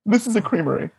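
mocking a pretentious server correcting the customer, high and prim; snooty correction. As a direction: sneer it like a snob correcting you. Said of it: "He's like".

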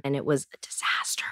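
low, hushed verdict on a bad date; confiding hush. Real, not acted. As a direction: drop to a near whisper. Confiding about a bad blind date.

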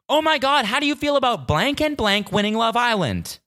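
high-pitched, gushing mock texter; mock gushing fan. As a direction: imitate an overexcited message in a high voice. Reads out the spoiler message mockingly.

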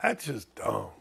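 low, quiet gruff aside; quoting dad. Retold or imitated. Dad scolding his son Dan, in a low mock mutter. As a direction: drop the voice as a disapproving father. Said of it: "To quote my dad".

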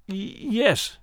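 hesitant, stammered little yes; a timid, quavering yes; trembling voice. Acted. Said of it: "said in a trembling voice".

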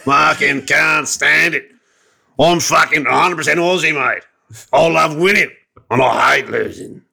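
put-on slow, emphatic broad Aussie accent; hammed-up ocker father, drawing the words out; old-fashioned Australian dad. Father insisting he hates losing, broad Australian bluster. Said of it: "Dad's quite an unreconstructed kind of old-fashioned Australian guy".

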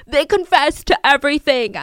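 usual speaking voice

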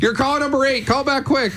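high excited caller announcement; radio contest promo. Acted.

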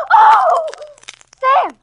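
high, loud horrified cry; shocked scream. Acted. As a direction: scream in sudden horror. Discovers dead body.

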